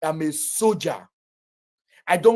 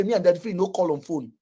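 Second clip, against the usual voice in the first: ordinary delivery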